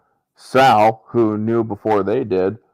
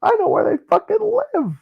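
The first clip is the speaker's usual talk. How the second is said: eager pitched-up informant blurting out everything; a snitch eager to please, voice pitched way up; eager high voice. Retold or imitated. Informant eagerly pitching himself to the brothers, high and rushed.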